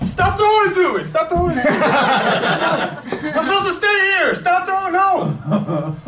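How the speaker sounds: playful yell. Acted. Fielder yelling during improv play.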